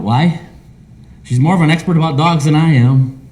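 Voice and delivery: usual speaking voice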